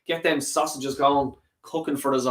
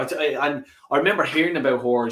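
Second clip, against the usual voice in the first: usual speaking voice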